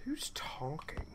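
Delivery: hushed spooked whisper. Real, not acted. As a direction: breathe the question, voiceless. Spooked aside.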